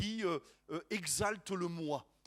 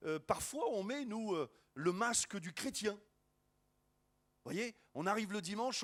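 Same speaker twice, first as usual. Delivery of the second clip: normal talking voice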